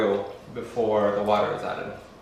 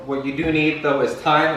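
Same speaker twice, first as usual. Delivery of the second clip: ordinary talk, nothing marked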